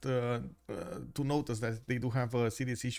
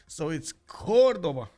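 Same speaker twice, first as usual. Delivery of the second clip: Cordoba said slowly, louder, stress on the O; slow, loud try at Cordoba with the accent; 3 semitones higher, 8 dB louder, slower than usual. Shown as a demonstration. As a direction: lean hard on the first syllable of Cordoba. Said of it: "the accent just means you give more emphasis".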